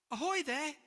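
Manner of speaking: eager call. Acted. George hailing the unseen voice from his boat, eager call. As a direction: call out across the water, keen. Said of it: "cried George".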